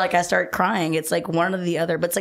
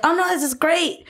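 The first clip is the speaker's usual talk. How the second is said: much higher, playfully gushing; mock delight; 10 semitones higher than usual. Woman retelling her twenty-three-year-old self, mock delight. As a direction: fake cheer at bad drinks. Retold drunk self-talk.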